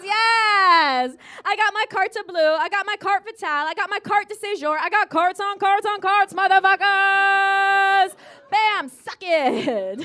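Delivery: loud, gloating boast; triumphant brag. Acted. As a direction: shout it like a victory lap. Triumphant boast about residency cards.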